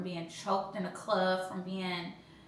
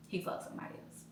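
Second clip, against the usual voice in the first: whisper; 9 dB quieter, faster than usual. Retold or imitated. Realizing he cheated.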